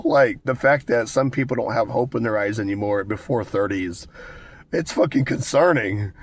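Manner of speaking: usual speaking voice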